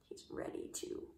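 whispered hint; secretive whisper. Real, not acted. Hushed aside to child.

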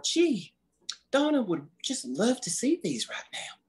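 high-pitched, mildly gushing thought; delighted gushing. Acted. Reading Jolene's note aloud to Donna, delighted gushing.

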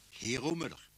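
ordinary delivery

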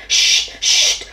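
two sharp shushing hisses; growled shush. A narrator's reading. Bear hushing the mouse, scolding shush. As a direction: shush twice, urgently. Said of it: "gronda l'ours".

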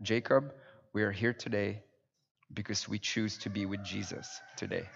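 soft, low, considered fatherly reply; collected, sincere answer. Retold or imitated. Father answering his boy's big question, quietly sincere. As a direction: answer the child gently and deliberately, voice dropped right down. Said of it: "collected my thoughts and I went".

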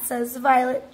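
ordinary delivery, nothing marked

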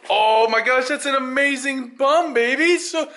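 put-on high, loud babying praise; playful gushing. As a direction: gush over your sweetheart. Gushing over girlfriend to appease her.

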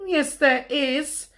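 high, slow insistent cub voice; Little Bear. A narrator's reading. Little Bear contradicts Big Bear, high insistent cub voice.

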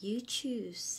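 whispered You choose; whispered demo. Shown as a demonstration. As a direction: breathe "You choose" unvoiced. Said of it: "teacher demo". (กระซิบ You choose)

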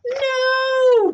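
high loud cry of horror. Acted. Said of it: "cried the boy".

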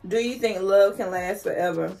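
ordinary talk

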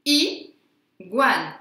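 "Iguane" broken into a loud i, pause, guane; syllable by syllable. Shown as a demonstration. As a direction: isolate the opening i of "Iguane", then guane. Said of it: "On entend le I au début".

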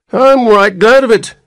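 put-on very high whiny old voice; whining old man. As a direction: whine like a peevish old man. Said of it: "whined the old man".

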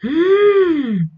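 sudden high gasp of shock; sharp high gasp. Real, not acted. Fan gasping at the twist, high sharp gasp. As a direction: gasp at the screen. Reaction to scene.

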